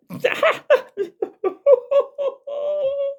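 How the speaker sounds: shrill hooting cackle; high cackling laugh. Acted. Jeremy laughing at the raging constable, high hooting cackle.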